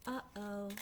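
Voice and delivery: very soft uh-oh; barely audible worry; the timid duckling whispering; whispered uh-oh. Acted. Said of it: "The last duckling just whispers".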